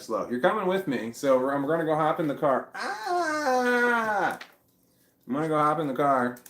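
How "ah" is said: sudden high yelp of surprise; startled cry. Real, not acted.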